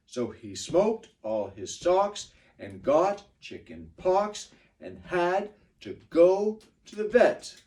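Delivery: nice and slow rhythm; slow reading. Shown as a demonstration. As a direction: read it slowly with the beat. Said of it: "I'll try it nice and slow".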